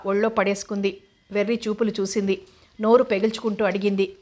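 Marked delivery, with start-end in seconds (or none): none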